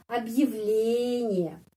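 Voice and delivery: Объявление drawn out, stress on ле; slow stress demo. Shown as a demonstration. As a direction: stretch Объявление and lean on ле. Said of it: "penekanannya pada huruf y ini". (Объявление pelan, tekanan pada ле)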